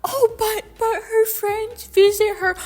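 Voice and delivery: mocking voice.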